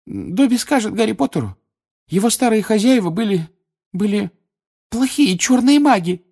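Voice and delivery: high elf voice, halting then loud; squeaky elf blurting out the forbidden; daring Dobby. A narrator's reading. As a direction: nerve yourself up to a bold confession. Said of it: "дрожа от собственной дерзости".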